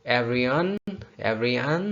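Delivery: ordinary talk, nothing marked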